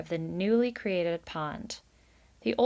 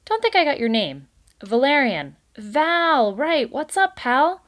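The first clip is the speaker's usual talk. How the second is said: high, loud fast-talking wheeler-dealer; a slick salesman schmoozing; brash boss beaver; 7 semitones higher, 8 dB louder than usual. A narrator's reading. Mister Kanad schmoozes Valerian, high loud salesman.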